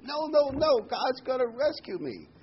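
shrill hammed-up refusal to the helicopter crew; stubborn refusal. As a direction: insist at the top of his voice.

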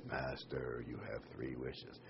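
deep, soft genie from the bottle; hushed deep genie greeting. Acted. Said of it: "And the genie says".

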